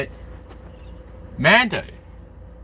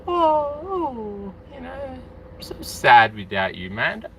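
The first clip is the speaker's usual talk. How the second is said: mock sad; 6 semitones higher, slower than usual. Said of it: "that's how he's feeling".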